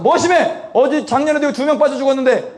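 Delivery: high, heated retold comeback; rude angry retort. Lifeguard throws past drownings at preacher, high angry retort. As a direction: imitate an aggressive man pressing his point. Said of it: "말이 심하시다고".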